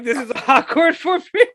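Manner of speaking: high, laughing through the words; nervous giggle while speaking; imitating an actress laughing awkwardly beside the director. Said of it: "she kinda, like, laughed".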